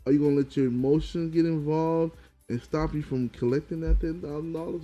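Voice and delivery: ordinary talk, nothing marked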